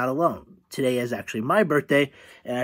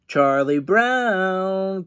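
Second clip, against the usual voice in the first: slow, lilting, higher drawn-out name; sing-song call; 5 semitones higher, much slower than usual. A narrator's reading. Lucy calling Charlie Brown over, sing-song. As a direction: stretch the name like a teasing tune. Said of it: "calls Lucy in a sing-song voice".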